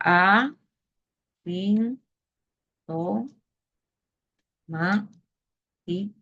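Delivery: word broken into separate syllables with long pauses. Shown as a demonstration. Teacher sounding out asintomático for the class, syllable by syllable. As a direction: say each syllable on its own, pausing between.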